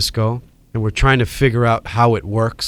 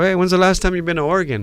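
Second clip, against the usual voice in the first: higher, fast and eager; knowing teasing callback; 8 semitones higher, faster than usual. Retold or imitated. Teasing Adam about Oregon, high and quick. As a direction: fire it off, already guessing the answer. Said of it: "and I go".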